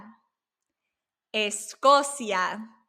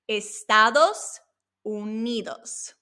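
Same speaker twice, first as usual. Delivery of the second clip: slow Spanish model; slower than usual. Shown as a demonstration. Teacher showing the flipped word order, slow and separated. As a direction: slowly separate "Estados" from "Unidos". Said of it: "we tend to flip the order of words".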